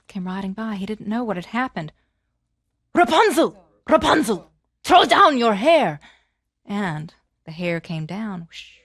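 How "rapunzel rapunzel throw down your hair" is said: calling up. Acted. Prince calls.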